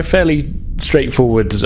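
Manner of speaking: normal talking voice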